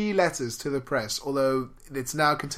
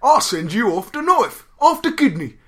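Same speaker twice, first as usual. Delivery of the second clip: high, loud, garbled Cockney reading; Cockney accent; 12 semitones higher, 8 dB louder than usual. Acted. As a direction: declaim it in over-the-top Cockney. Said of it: "I'm reading it how it's originally intended".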